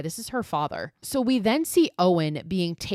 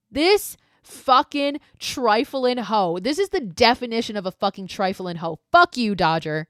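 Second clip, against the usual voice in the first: loud, emphatic indignation at a villain; fed-up, disgusted outburst; outraged; 4 semitones higher, 6 dB louder than usual. Real, not acted.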